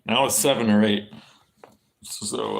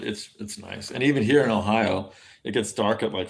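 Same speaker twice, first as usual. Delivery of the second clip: ordinary talk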